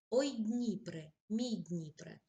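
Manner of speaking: slow, heartfelt poetry reading; poem recitation. Acted. Reads poem example.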